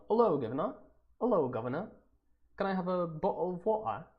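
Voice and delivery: playful mock English, punched greeting; put-on English accent. Shown as a demonstration. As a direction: demonstrate a jokey Cockney-style English accent. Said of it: "You butchered that accent".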